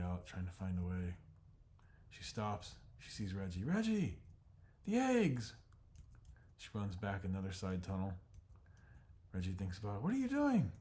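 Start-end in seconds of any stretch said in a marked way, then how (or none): 3.6-5.5 high, loud alarmed call; Jenny shouting to Reggie about the eggs; panicked yell. Acted. Jenny calls out in fire. 10.0-10.7 a much higher, louder cry of disbelief; alarmed shout. Acted. Calls after Jenny into smoke.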